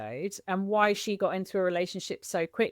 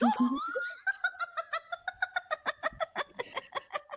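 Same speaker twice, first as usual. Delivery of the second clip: big laugh; 6 dB quieter than usual. Real, not acted. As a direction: burst out laughing. Reacting to mum's remark.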